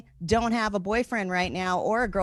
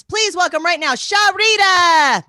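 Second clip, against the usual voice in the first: announcer call; 8 semitones higher, 11 dB louder, slower than usual. Acted. Host announcing next comic.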